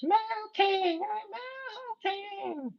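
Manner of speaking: very high, soft, wailing witch voice; melting witch. Shown as a demonstration. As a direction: wail it like the witch dissolving. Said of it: "That's my, that's my Wizard of Oz voice".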